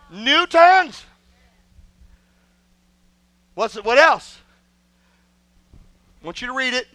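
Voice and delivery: usual speaking voice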